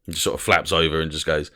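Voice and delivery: normal talking voice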